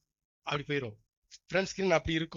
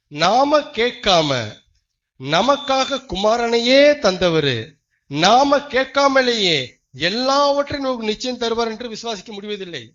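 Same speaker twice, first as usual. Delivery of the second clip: loud, slow, drawn-out pulpit proclamation; preaching at full force; 6 semitones higher, 13 dB louder, slower than usual. Acted. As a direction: hammer each phrase slowly and loudly. Preaching climax. (முழு வேகத்தில் உரத்த பிரசங்கம்)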